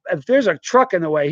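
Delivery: ordinary talk, nothing marked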